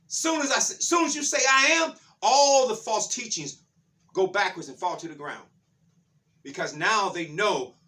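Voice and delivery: high, loud proclamation easing down; full-force preaching. Real, not acted. Preacher declaring false teachings fall, high and forceful. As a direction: burst out high, then settle into weight.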